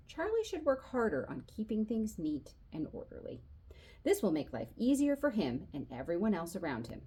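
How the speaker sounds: ordinary delivery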